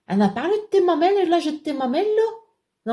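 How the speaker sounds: high-pitched mock outrage; indignant retort. Retelling a spat with a rival, high indignant retort.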